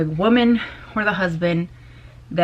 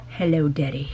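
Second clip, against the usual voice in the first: flirty; 4 semitones lower, 4 dB quieter than usual. Pretend. Flirting with Jason Statham.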